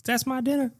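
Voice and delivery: very high, very soft squeak guarding food; squeaky mock protest. Joking about a reality show fight, very high soft squeak. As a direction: squeak it out like a tiny person defending dinner. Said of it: "And they're like".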